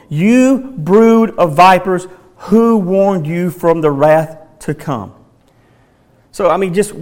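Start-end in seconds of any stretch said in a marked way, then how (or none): 0.1-5.1 "You brood of vipers" drawn out slowly, weight on "you"; slow, heavy prophetic rebuke; slow condemning rebuke. Shown as a demonstration. John rebuking the Pharisees, slow and accusing. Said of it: "I would have loved to have heard how this was spoken".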